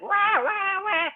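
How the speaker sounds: put-on a high baby wah breaking off into a smile; baby cry fading. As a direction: let the wail stop short. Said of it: "lifted the baby, crying".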